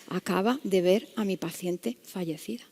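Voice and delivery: slow, near-voiceless whisper; hushed realisation. Retold or imitated. Doctor realises he saw her dead patient, hushed whisper. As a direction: let the realisation sink in, whispering. Stunned realisation, told as thought.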